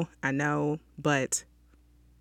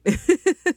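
a quick high chuckle, louder; short high laugh; 14 semitones higher, 7 dB louder than usual. Real, not acted. Host laughing to listeners about needing degrees, short high laugh. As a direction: laugh at your own point. Laughing after own remark.

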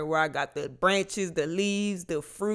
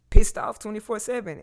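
sudden loud outburst; 6 semitones higher, 10 dB louder than usual. Real, not acted. Venting about constant anger.

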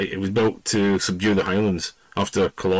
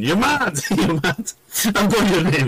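retold shouting; 10 semitones higher than usual. Officer shouting at the startled guard up the road, indignant yell. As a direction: yell it after him, outraged. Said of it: "he went up the road shouting".

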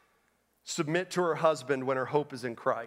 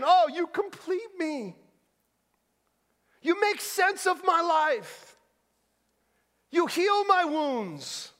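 high, drawn-out mock adoring wife; adoring woman; 13 semitones higher, 4 dB louder, slower than usual. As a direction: imitate a woman gushing to her man, slowly and high. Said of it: "if a woman says to the man".

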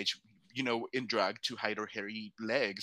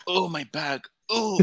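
high mock groan of pain; acted pain; 8 semitones higher, 4 dB louder than usual. Retelling a painful roll down the stairs, mock pained. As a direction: yelp it out, hamming up the hurt. Acting out pain while rolling.

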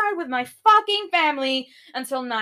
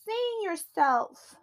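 much higher, whiny mock voice; mocking friends' text; 8 semitones higher than usual. Vlogger mocking worried friends' text, high simpering. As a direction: read it in a simpering voice. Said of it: "texted me saying".

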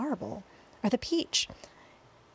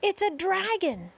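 high-pitched cry of alarm; alarmed shouting; 10 semitones higher than usual. A narrator's reading. Policemen and firemen spot a monster, alarmed shouting. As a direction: shout the name of the monster in fright. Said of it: "all started shouting at once".

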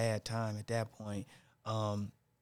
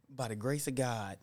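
ordinary delivery

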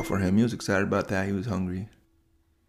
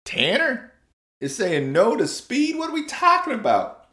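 much higher, incredulous; astonished parents; 12 semitones higher than usual. Acted. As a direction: parents who can't believe it. Parents voiced in disbelief.

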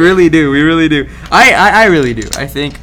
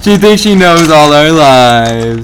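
loud, slow, drawn-out mock; mocking echo; 6 dB louder, much slower than usual. Friend echoing a jab at her to the guys, mocking. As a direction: stretch it out loudly to make fun of her.

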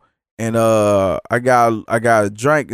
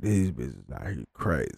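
low, much softer grumble under the breath; muttered aside; 12 semitones lower, 12 dB quieter than usual. Retold or imitated. Grumbling to himself about the staff, low muttered aside. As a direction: say it to yourself, resigned. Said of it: "I just like".

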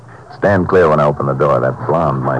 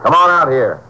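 high, raised call across the yard; firm outdoor holler; 11 semitones higher than usual. Acted. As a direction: call her out from hiding. Ranger calls to girl hiding outside.

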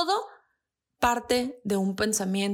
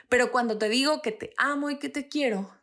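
ordinary talk

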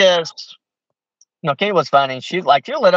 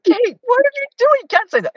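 scandalized elder. Retold or imitated. Elder shocked at Kate again.

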